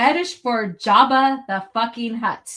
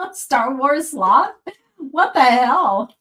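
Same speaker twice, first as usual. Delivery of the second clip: baffled; 4 semitones higher, 7 dB louder, much slower than usual. Real, not acted. Baffled by chat message.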